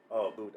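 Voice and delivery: imitated "a boot" with long oo; mimicking "a boot", oo for ow; coworker's "aboot". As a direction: quote the Canadian "boot" vowel for "about". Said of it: "he'd be like".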